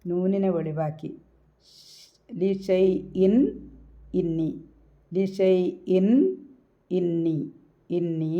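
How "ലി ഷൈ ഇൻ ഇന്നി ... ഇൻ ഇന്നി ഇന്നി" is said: ഇന്നി with a clear, plainly sounded nn; ഇന്നി recited slowly with a distinct n; clear n recitation. Shown as a demonstration. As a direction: say ഇൻ and ഇന്നി with the n made distinct, not hummed. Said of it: "നൂനിനെ വെളിവാക്കിയിട്ട് pronounce ചെയ്യുക".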